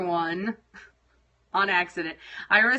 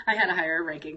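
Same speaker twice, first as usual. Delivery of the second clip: laughing; 6 semitones lower, 3 dB quieter, faster than usual. Real, not acted. Laughing about friends.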